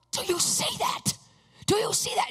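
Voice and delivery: high, breathy, frantic questioning; retold screaming disbelief. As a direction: re-enact freaking out in a squeaky pitch. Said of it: "I start screaming".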